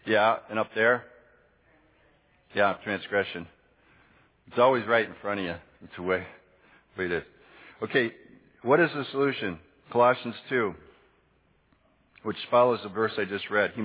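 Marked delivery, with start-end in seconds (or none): none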